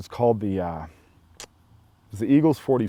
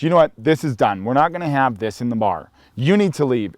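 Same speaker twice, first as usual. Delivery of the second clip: bartender laying down law; 3 semitones higher, 5 dB louder than usual. Retold or imitated. Bartender Patty orders the troublemaker out, loud and firm. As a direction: shut the fight down like you own the bar.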